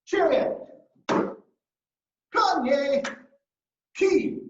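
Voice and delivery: loud, high drill call; sensei's punchy count; martial arts command shout. Shown as a demonstration. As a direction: call out the moves with sharp force. Leads martial arts drill calls.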